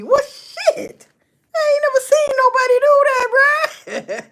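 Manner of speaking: high, loud disbelief breaking into a laugh; can't believe anyone rinses meat in the sink; incredulous outburst. Real, not acted. Telling listeners about the roommate's sandwich, loud high incredulous laugh. Outraged retelling of sandwich story.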